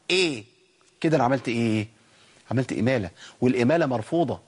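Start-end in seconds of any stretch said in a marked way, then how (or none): 0.1-0.4 إيه as a smile-spread "eh" for "a"; wide-smile imala. Shown as a demonstration. Teacher showing the fault, wide-smile imala on إيه. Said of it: "مع حركة الفتح ابتسامة عريضة".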